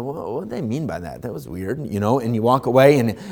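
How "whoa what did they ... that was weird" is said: soft, puzzled mumble to yourself; puzzled mutter. Retold or imitated. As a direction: mutter it, confused, walking away. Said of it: "and go".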